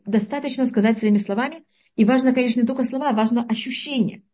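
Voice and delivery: ordinary talk, nothing marked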